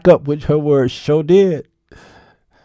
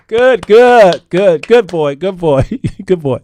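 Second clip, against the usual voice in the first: put-on loud, higher good boy praise; praising wife; 6 semitones higher, 9 dB louder than usual. Wife praising her husband like a good boy, loud and high. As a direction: praise him like a puppy.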